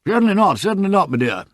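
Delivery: hearty military agreement; hearty Colonel. A narrator's reading. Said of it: "said the Colonel heartily".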